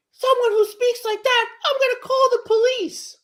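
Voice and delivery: very high, loud indignant squeal; mock outraged lady. Imitating the lady threatening police in a high squeal. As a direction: shriek it like a scandalized Karen. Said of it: "she's like".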